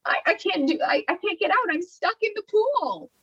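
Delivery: high, stammering mock wail, stretched at the end; imitating a tearful, high-pitched plea; retold crying panic. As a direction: wail it like you're stuck and helpless. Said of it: "then I started crying".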